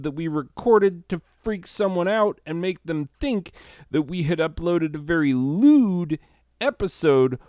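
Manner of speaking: high-pitched, worked-up mock tirade; exasperated rant. Client scolding the voiceover guy over fourteen takes, high exasperated rant. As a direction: pile up the complaints, voice pitched well up.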